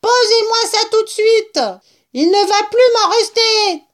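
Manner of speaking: high, loud, squealing rat; yelling rat. A narrator's reading. Rat guarding his cider from drinkers, high yell. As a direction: screech the order in outrage. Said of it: "hurla le rat".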